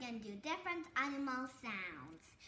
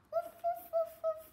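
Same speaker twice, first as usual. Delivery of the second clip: much higher little yaps; small dog yap; 13 semitones higher, 5 dB louder than usual. Shown as a demonstration. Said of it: "Small dog".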